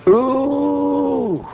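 long high-pitched ooh; high angry "ooh". Retold or imitated. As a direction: hold a high, mad ooh. Acting out how mad he was.

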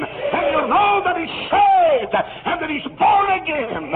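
slow, high, loud proclamation; preaching shout. Real, not acted. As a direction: stretch it out and cry it to the rafters. Preaching climax.

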